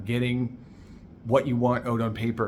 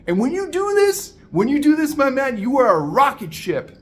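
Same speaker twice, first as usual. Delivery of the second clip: much higher, louder pep-talk build; hyped-up rally; 11 semitones higher, 6 dB louder than usual. Real, not acted. Coach pumping up his listener, loud and soaring. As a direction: fire them up like a rocket launch.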